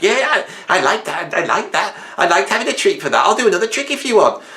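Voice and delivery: high, fast, keen dog; eager dog voice. Retold or imitated. Eager dog offering tricks to its owner, high and fast. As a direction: overeager to please. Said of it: "dog voiced responding".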